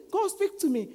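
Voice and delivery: very high-pitched mock plea; pleading with God. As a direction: repeat the prayer, high and insistent. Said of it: "And then we say".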